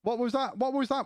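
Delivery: high, puzzled asking about a strange noise; surprised question. Real, not acted. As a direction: really caught off guard, asking twice. Streamer hears an unknown noise.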